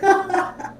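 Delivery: high burst of cackling; quick high giggling; amused laugh. Real, not acted. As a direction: crack up at a silly line. Laughs at the joke just read.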